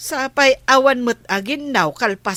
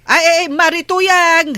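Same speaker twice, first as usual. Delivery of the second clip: loud, higher call across the yard; 5 semitones higher, 5 dB louder than usual. Acted. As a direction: shout after her so she hears from afar. Calling out to approaching neighbor. (napigsa nga ayab)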